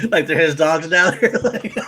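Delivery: can't hold back the laughter; laughing. Real, not acted. Co-host cracking up retelling the dogs scene, laughing.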